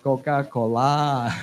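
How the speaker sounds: Coca-Cola slow, open vowels, final syllable weighted; Thai-style Coca-Cola. Shown as a demonstration. Teacher repeating the Thai-style Coca-Cola slowly. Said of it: "Last syllable is very important".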